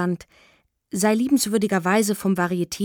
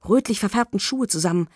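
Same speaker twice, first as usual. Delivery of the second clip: ordinary delivery, nothing marked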